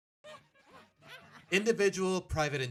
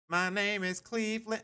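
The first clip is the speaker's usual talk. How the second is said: playful character voice; 6 semitones higher than usual. Pretend. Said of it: "Okay, I'll stop".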